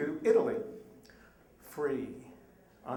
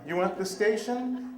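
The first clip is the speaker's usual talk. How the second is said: usual speaking voice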